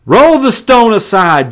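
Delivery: loud, high, angry order; angry command. Acted. Jesus ordering mourners at the tomb, loud and angry. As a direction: bark the command. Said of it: "Jesus was still angry".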